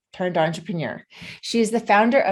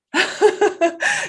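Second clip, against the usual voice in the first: a real, high burst of laughter; bright laugh; 10 semitones higher than usual. Real, not acted. Host laughing at the guest's company name, bursting out.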